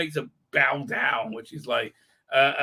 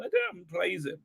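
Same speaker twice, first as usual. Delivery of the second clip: ordinary delivery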